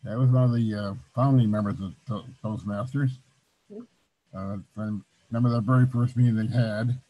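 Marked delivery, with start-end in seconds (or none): 0.1-3.2 louder, for someone hard of hearing; speaking louder. Real, not acted. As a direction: raise the voice so the room hears. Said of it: "Can you speak louder, please?".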